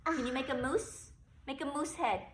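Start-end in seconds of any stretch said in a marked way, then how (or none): none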